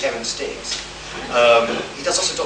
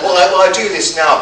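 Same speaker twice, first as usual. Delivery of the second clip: laughing; 6 semitones higher, 11 dB louder than usual. Retold or imitated. Mafia driver quoted, laughing.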